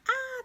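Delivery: high loud panicked ah; a frazzled burst of alarm; mock overwhelmed cry; overwhelmed yelp. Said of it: "how quickly to go from".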